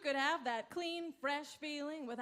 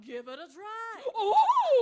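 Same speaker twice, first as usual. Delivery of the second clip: loud, slow laughing shout; 6 semitones higher, 11 dB louder, much slower than usual. Acted. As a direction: bellow it as the cold hits. Pitchman blasts her.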